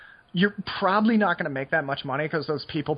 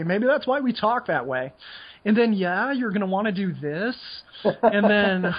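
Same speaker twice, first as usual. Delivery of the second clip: Seattle yuppie accent; 5 semitones higher than usual. Shown as a demonstration. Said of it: "I went into my super feminine yuppie Seattle accent there".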